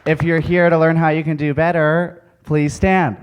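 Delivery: loud call to the audience. Real, not acted. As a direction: project it to the back of the hall. Calling out to audience.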